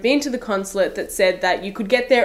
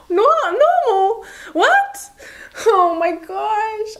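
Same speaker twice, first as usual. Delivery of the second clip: high-pitched squeal of disbelief; incredulous self-mockery; 12 semitones higher, 4 dB louder, slower than usual. Real, not acted. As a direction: cringe at yourself, voice shooting up and drawn out. Mocking her own stupid answer.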